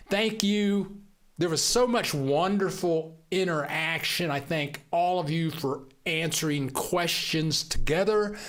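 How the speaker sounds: ordinary talk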